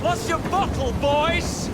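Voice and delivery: loud, high jeering yell; taunting shout. Acted. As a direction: shout a mocking taunt after them. Taunting fleeing enemies.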